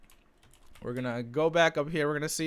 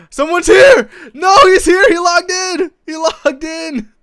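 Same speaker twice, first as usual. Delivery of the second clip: gasp, then shrieking much higher and louder in real alarm; panicked shout; 18 dB louder, slower than usual. Real, not acted. Raider caught as the base owner logs in, panicked shout. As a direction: yell it like you just got caught. Enemy logs in mid raid.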